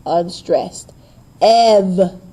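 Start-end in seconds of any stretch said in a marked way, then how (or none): 1.4-2.2 EV loud and drawn out; heavy stress on EV; stressed syllable. Shown as a demonstration. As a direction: punch EV and hold it.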